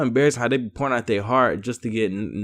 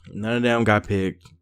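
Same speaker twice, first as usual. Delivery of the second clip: ordinary delivery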